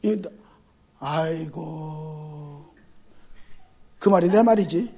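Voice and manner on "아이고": long, much softer groaning sigh; drawn-out sigh aigo. Acted. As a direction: let out a weary sigh. Sigh of exasperation. (길게 탄식하듯)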